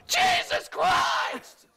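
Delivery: high, slow drawn-out scream; yelled. Retold or imitated. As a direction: scream it across the room in shock. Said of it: "she just stands up and yells out".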